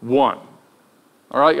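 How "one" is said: raised, incredulous mock-sarcastic question. Disciples answer a clueless traveler, sarcastic. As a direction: lean hard on ONLY, imitating a sarcastic jab. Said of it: "they were sarcastic".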